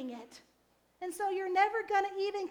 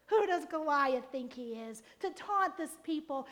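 usual speaking voice

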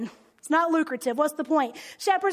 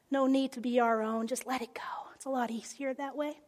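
soft hush; 4 semitones lower, 8 dB quieter, faster than usual. Acted. Pastor softly urges letting go.

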